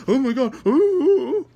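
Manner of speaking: high, loud hammed-up panicked cries; mock terror. Imagined prisoner near the bomb, hammed-up terrified cries. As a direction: overplay the prisoner's dread.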